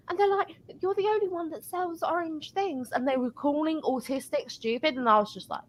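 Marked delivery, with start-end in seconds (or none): 0.8-2.9 higher, softer customer imitation; mock customer voice. Pitched-up mock of a customer praising the lone cashier.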